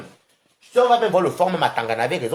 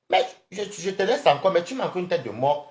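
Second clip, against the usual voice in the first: ordinary talk, nothing marked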